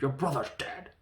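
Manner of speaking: mock-blunt death announcement, mostly loud; hammed-up imitation of tactless bluntness; straight-up blunt. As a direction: deliver the bad news with no cushioning. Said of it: "it was just straight up".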